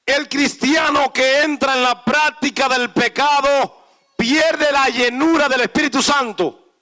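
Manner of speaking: loud, high sermon cadence; fiery preacher, slow and emphatic at the end; full-force preaching. Real, not acted. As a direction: preach it hard, slowing on each pierde.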